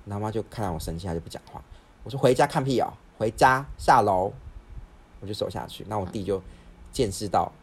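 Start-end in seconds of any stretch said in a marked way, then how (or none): 2.2-4.3 louder, slow, spelled-out order; stern command. Retold or imitated. Daughter sending family downstairs, slow stern command. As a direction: lay down the law slowly.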